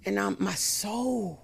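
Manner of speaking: normal talking voice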